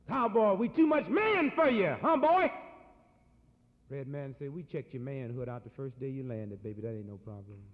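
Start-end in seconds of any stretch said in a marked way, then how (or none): 0.1-2.5 loud cocky cowboy taunt; swaggering loud brag; boastful shout. Retold or imitated. 4.5-7.6 cool low reply. Retold or imitated. Red man answers coolly.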